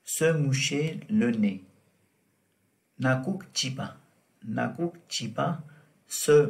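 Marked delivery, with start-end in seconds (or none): none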